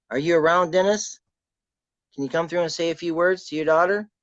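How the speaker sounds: calling out to a spirit. Real, not acted.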